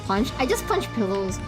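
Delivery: ordinary talk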